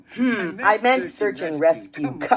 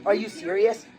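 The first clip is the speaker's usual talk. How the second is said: ordinary talk, nothing marked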